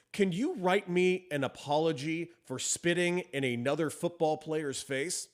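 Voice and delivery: slow, measured voice-command delivery; slow dictation. Real, not acted. Host dictating an apology request to Siri, slow and deliberate. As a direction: spell it out slowly for the voice assistant.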